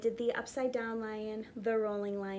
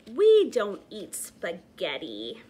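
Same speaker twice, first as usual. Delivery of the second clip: slow, much louder growl; 9 dB louder than usual. Acted. Lion refusing the child's request for spaghetti, growling. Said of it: "The lion growled".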